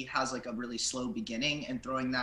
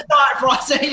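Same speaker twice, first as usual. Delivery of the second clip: laughing; 12 semitones higher, 14 dB louder than usual. Real, not acted. Laughing at own answer.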